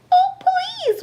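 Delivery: whimper. Acted. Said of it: "whimpered Eddie".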